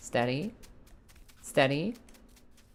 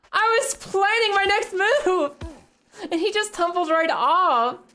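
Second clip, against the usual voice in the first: slow, much higher frustrated wail; drawn-out anguished complaint after falling; mad; slower than usual. Real, not acted. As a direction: wail it out, crying in exasperation. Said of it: "I'm so mad".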